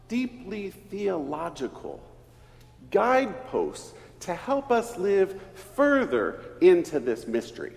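ordinary delivery

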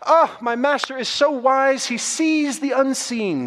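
a hint of an admiring servant; housekeeper. Old housekeeper praising her master, lightly voiced. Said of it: "replied the housekeeper".

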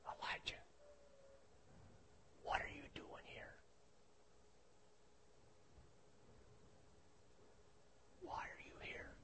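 breathy whisper of God, no voice at all; God whispering gently to Elijah in the cave. Acted. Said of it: "He whispered".

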